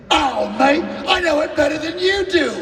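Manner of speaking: mock British know-it-all; cocky Brit. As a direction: show off to a Beatle. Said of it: "He goes".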